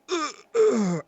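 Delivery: high, loud mock groans of strain; imitated straining grunts. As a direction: ham up a man straining on the toilet. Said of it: "I just heard a guy go".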